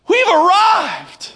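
slow, soaring shout of triumph; mock triumph. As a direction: crow it out like you've conquered the world. Mock triumphant proclamation, louder.

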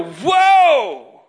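a loud, high mock-startled whoa; startled shout. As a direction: recoil out loud, mock alarmed. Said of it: "we go".

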